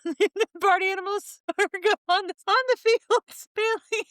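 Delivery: high-pitched, helpless giggling through the words; cracking up mid-sentence; laughing while talking. Real, not acted. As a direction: barely get it out for laughing.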